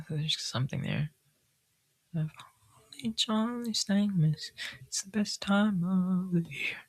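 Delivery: high, soft, slow dreamy whisper; whispery sing-song. Pretend. Reader musing on the holidays, whispered and high. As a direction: croon it softly like a dreamy child.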